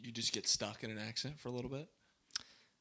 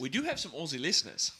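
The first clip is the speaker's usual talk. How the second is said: put-on Australian accent; 4 semitones higher, 7 dB louder than usual. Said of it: "we can talk like Aussies".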